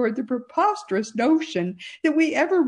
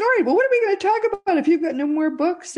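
quick, higher-pitched quoted question; retold pressing question; 8 semitones higher, faster than usual. Host retelling her question to Tricia, quick and pressing. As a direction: rattle it off in a raised voice.

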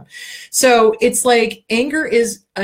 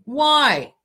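normal talking voice

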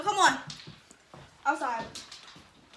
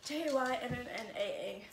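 normal talking voice

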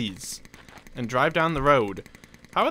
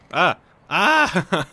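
startled yelp; 4 semitones higher, 5 dB louder than usual. Real, not acted. Startled by a stunt jump.

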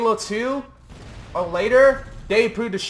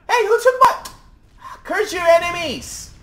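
high outraged shout; 10 semitones higher, 4 dB louder than usual. Real, not acted. Ally attacked him in game.

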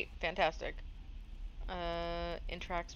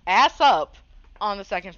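loud exclamation; 4 semitones higher, 17 dB louder than usual. Real, not acted. Laughing at her dead body.

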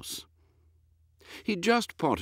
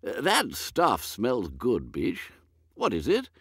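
put-on high, dithering old lord, higher and fluttery; a woolly, absent-minded aristocrat, higher pitched; vague old earl; 4 semitones higher than usual. Lord puzzling over dinner with his butler, high and dithery.

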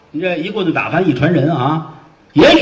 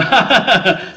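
mock laugh; 7 semitones higher than usual. Officer laughs.

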